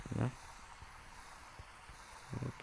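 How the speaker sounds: ordinary delivery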